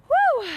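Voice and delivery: shouted woo, high and loud; loud high woo cheer. Real, not acted. Cheering end of set.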